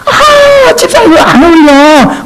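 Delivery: high, exclaiming critic of an outfit; mock a church member criticizing clothes; picky critic. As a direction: deacon scolded over his outfit, high and mocking. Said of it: "그러지 마".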